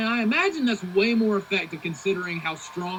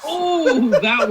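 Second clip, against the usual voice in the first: excited reaction; 6 semitones higher, 6 dB louder than usual. Real, not acted. Elbow drop to the face.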